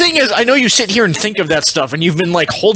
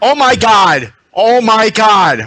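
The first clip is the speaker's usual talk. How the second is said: stunned exclamation, drawn out slower; real astonishment; 2 semitones higher, 4 dB louder, slower than usual. Real, not acted. Seeing the picture of the product, astonished. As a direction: blurt it out in amazement.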